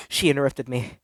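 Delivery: usual speaking voice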